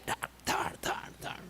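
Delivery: hushed, toneless slap noises; soft repeated smacks made with the mouth; imitated slapping. Doctor slapping the newborn, imitated in hushed thuds. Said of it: "And they slap him".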